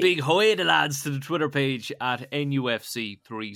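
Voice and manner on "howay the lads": high, loud Geordie cheer; Geordie accent. Acted. Host greeting the Newcastle fan page, mock Geordie accent. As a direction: belt out a mock Geordie call. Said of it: "That was a bad Geordie accent".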